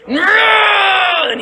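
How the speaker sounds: long, very high shouted cry; falling yell. Acted. Merrick toppling off the ship, long high yell. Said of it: "not screaming, but he's yelling".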